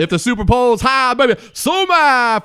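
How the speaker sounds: high-pitched showy sign-off; pitched-way-up radio personality shtick; hammed-up catchphrase. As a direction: sing out the catchphrase with swagger. Host hamming up his sign-off catchphrase.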